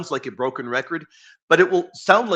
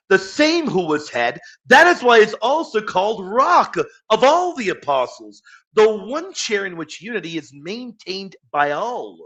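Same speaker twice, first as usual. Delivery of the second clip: louder and higher, swelling through the quote; fervent reading; 6 semitones higher, 7 dB louder than usual. Real, not acted. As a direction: read it like a proclamation. Rereading the quote with fervour.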